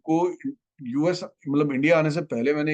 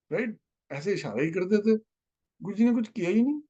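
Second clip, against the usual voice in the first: hurt complaint; 9 semitones higher than usual. Retold or imitated. Hurt complaint about Guruji.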